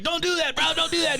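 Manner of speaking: high kid voice, mock scream. The game kid yelling at him, high mock scream. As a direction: squeal it like an angry twelve-year-old. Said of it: "twelve-year-old boy screaming at me".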